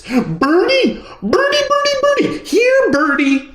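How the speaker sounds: put-on very high toddler voice calling the pigeons; sing-song toddler calling birdie, high and loud; little boy chasing pigeons, calling them high; calling birds. A narrator's reading. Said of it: "he called".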